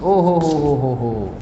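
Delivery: soft, low run of oh-ho-hos; quiet drawn-out mock amazement; low mock-awed "oh ho ho". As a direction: hush a teasing oh ho ho, slowly. Reacts to the dictated question.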